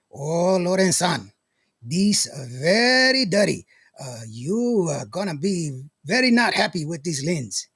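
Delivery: slow, drawn-out mock Japanese accent. Imitating a friend from Japan about a scratched lens, mock Japanese accent. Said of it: "I like using that Japanese accent".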